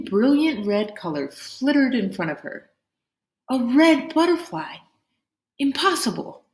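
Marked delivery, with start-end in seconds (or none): 3.5-6.4 surprised. A narrator's reading. Pinmai sees red butterfly.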